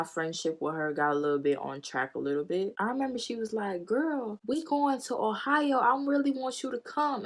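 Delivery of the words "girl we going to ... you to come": mock sweet invite. Imitating friend's invite.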